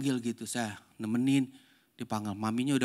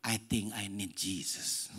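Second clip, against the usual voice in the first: put-on grown-up child; 5 semitones lower, 6 dB quieter, slower than usual. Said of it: "Kayak orang gede".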